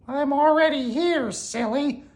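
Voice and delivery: very high little mouse voice; squeaky mouse. A narrator's reading. Mouse corrects frightened Rabbit, squeaky voice. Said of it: "Mouse squeaked".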